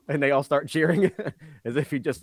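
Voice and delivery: ordinary talk, nothing marked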